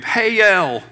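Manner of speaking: Hey-el stretched into two syllables, hey then el; southern hell. Shown as a demonstration. Pastor showing the congregation the Southern hell, drawn out. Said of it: "the southern way of".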